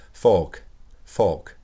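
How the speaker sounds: British "fork", the r almost dropped; British accent. Shown as a demonstration. French teacher contrasting English with American, British accent. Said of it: "Ils diraient quelque chose plus du genre".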